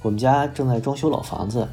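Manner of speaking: ordinary talk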